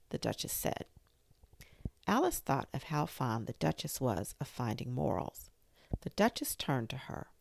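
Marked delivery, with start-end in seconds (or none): none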